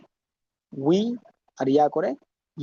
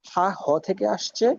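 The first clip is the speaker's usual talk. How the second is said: usual speaking voice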